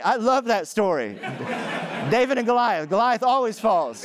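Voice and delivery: high, laughing delight at the story's ending. Real, not acted. Pastor to congregation, delighted by the story, laughing. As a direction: laugh it out, tickled by the twist.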